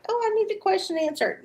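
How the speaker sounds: quick, high-pitched whiny mimicry; mocking imitation. Host mimicking late question askers, mocking high voice. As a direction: mimic pleading latecomers in a high, rushed voice. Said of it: "everybody's like".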